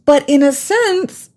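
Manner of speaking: high, slow, pointed call-out; emphatic indignation. Real, not acted. Mom calling out neglectful parents, indignant and high. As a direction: rise up and drive the point home.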